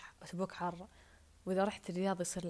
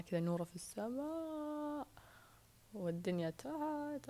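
higher, slow, floating boast; dreamy boast; 6 semitones higher, 4 dB quieter, slower than usual. Real, not acted. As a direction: lift it up high and slow, savouring it. Boasting she is above all.

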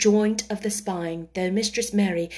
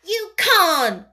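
put-on high, loud child's shout; angry shout; 9 semitones higher, 6 dB louder than usual. A narrator's reading. Mary contradicts the hysterical boy, shouting. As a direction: shout him down fiercely. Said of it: "shouted Mary".

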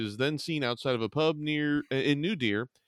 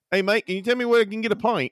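put-on higher, louder Aussie accent for the wallaby; Australian wallaby voice; 8 semitones higher, 4 dB louder than usual. Wallaby asking a Scottish pub for a pint, in an Australian accent. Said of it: "I'm just seeing this wallaby now".

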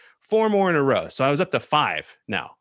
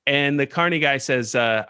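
ordinary delivery

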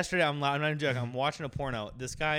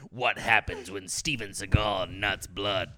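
horror trailer voice; 7 semitones lower than usual. Pretend. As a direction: growl it like a movie trailer narrator. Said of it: "Let me do a movie trailer".

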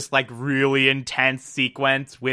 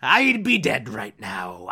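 pirate voice; 4 semitones higher than usual. Pretend. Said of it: "I don't know why Olivia's a pirate now".

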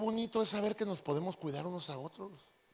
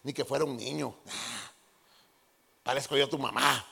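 dismissive wife; 6 semitones lower than usual. Retold or imitated. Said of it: "Pero a veces".

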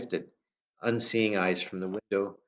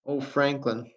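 voice cracking; 6 semitones higher, slower than usual. A narrator's reading. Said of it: "her voice cracked".

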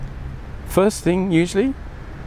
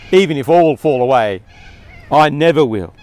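arrogant boast; 5 dB louder than usual. Retold or imitated. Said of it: "Peter's rather arrogant response".

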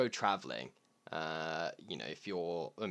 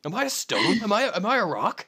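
slightly raised, badly imitated American accent; 6 semitones higher than usual. Imitating the American dude, bad American accent. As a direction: badly mimic an American's puzzled questions. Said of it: "I'm doing a terrible job of his accent".

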